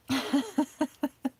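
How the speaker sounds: high, giggly run of laughter; amused laugh. Real, not acted. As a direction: giggle helplessly after a groan. Laughing after mock groan at reality.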